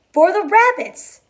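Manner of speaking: high, loud joyful cry of rabbits; Lenny shouts it, George echoes plainly; shouted. A narrator's reading. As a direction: yell it out happily. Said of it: "Lenny shouted".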